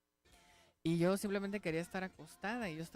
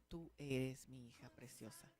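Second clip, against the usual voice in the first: soft voice of God; 5 semitones lower, 10 dB quieter than usual. Retold or imitated. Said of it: "con el mismo tono".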